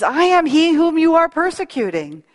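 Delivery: Jesus answering Saul from the light. Retold or imitated. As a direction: declare it with lifted authority. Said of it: "And he says".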